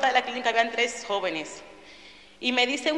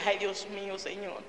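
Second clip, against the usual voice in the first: softly breathed plea to God, much softer; hushed prayer; 2 semitones lower, 9 dB quieter than usual. Retold or imitated. Woman recalling begging God's forgiveness, hushed and soft. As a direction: murmur it like a private prayer.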